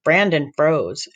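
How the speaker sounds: ordinary delivery, nothing marked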